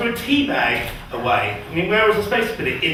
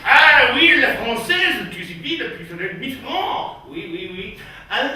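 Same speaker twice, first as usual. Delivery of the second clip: mock French; 5 semitones higher than usual. French server answering a drink order, exaggerated French. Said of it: "whenever you see the French".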